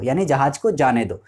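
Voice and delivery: usual speaking voice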